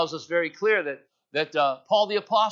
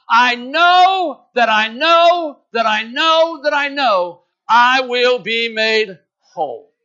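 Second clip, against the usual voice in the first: loud, higher and slow, each "I know" pounded; fervent declaration; 8 semitones higher, 11 dB louder, much slower than usual. Acted. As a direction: proclaim it like a woman staking her life on it. Woman's declaration of faith, climax.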